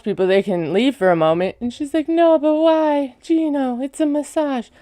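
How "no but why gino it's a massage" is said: high, drawn-out retold complaint; mock pleading protest. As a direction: imitate pleading to keep the treat going. Retold protest at ending massage.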